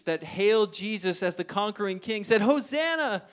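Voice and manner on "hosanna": jubilant cheer. Retold or imitated. Crowd hails the king.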